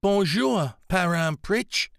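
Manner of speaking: holding back tears as she greets him; voice beginning to crack. A narrator's reading. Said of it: "her voice beginning to crack".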